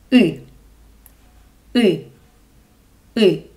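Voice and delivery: ы like a short English i, central; demonstrated vowel. Shown as a demonstration. Teacher shows the vowel и. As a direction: say ы short, tongue pulled back. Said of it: "the last vowel for today".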